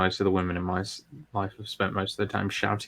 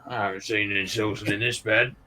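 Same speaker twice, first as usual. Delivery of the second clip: Cockney accent. Shown as a demonstration. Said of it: "How can you say it in a Cockney accent".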